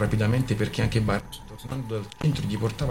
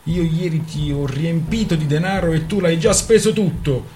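angry reproach; 6 semitones higher, 5 dB louder than usual. Acted. Couple quarrelling over money.

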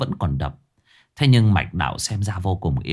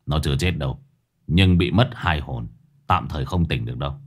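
put-on grim old sorcerer; 6 semitones lower than usual. Old sorcerer Lão Năm speaks.

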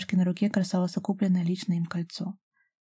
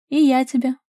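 higher, louder cry back; calling out; 6 semitones higher, 6 dB louder than usual. A narrator's reading. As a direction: call it out to him in reply. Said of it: "выкрикнула Дашка". (выкрикнуть в ответ)

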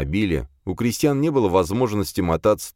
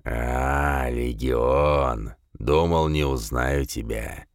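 low, slow gloating fiend; malicious demon voice; 3 semitones lower, slower than usual. A narrator's reading. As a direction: sneer it out with malice. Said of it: "злобно рассмеялась тварь".

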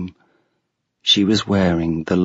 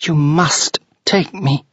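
put-on a touch of childish anger, higher; angrily; 7 semitones higher than usual. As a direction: insist like a cross little girl. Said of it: "Allegra said angrily".

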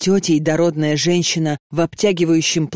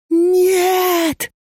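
shrill, much higher desperate cry of no; desperate scream; 13 semitones higher than usual. A narrator's reading. Girl waking to strange women, screaming in despair. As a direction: scream no in terror. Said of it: "отчаянно закричала Леночка".

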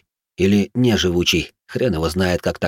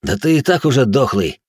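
higher, sneering retort; bare your teeth and mock him; snarling; 4 semitones higher than usual. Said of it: "в ответ оскалился я".

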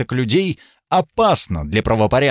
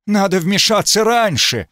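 sudden urgent outcry, raised; crying out; 8 semitones higher than usual. Acted. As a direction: blurt it out in alarm. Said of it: "Он вскрикнул". (резкий вскрик)

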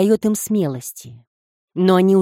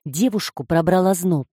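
plain reading voice

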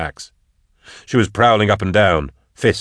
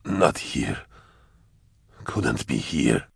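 low, soft, slow and halting; ragged dying voice; 7 semitones lower, 6 dB quieter, slower than usual. A narrator's reading. Dying hunter confessing to Drusha, ragged and weak. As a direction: labor out the words with long gaps. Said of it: "his breathing was ragged".

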